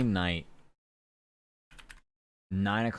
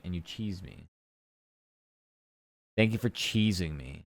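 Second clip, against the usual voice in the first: normal talking voice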